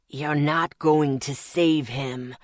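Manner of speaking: slow, low demonic hiss; a low, slow hissing threat. Acted. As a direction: hiss it from the dark, slowly. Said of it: "the demon hissed".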